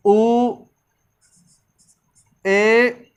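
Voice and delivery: आ, ई, उ, ए, ऐ, ओ, औ each said alone with pauses; long vowels dictation. Shown as a demonstration. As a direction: spell out the long vowels one by one. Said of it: "दीर्घ स्वर कौन कौन से होते हैं?". (दीर्घ स्वर एक-एक करके बोलें)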